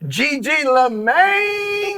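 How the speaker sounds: high, long-held ring announcer call; big drawn-out introduction shout; announcer's name call. Acted. Climax of the guest introduction.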